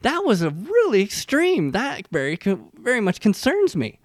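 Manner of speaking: high-pitched imitation of an outraged wife; mock freak-out. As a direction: scold him as the freaked-out wife, high and loud. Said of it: "the wife is just freaking out".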